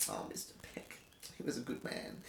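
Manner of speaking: hushed wistful sigh; breathy whisper. Acted. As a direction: whisper it fondly, low. Mock wistful aside, whispered low.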